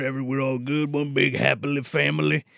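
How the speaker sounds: lightly voiced sly villain; smug vampire. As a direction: play the smug monster brushing it off. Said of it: "He's like".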